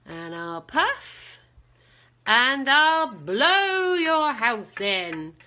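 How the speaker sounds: slow, building wolf threat, loud on blow; wolf threatening the little pig; big bad wolf. A narrator's reading. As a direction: stretch it out and swell to a loud blow. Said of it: "And so the wolf says".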